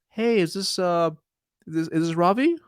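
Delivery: high, halting and hesitant; unsure caller with questioning lifts; weak opener. Shown as a demonstration. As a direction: stumble through it like you don't belong. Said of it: "Like, they sound weak".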